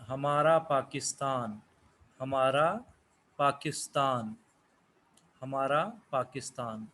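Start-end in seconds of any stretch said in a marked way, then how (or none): none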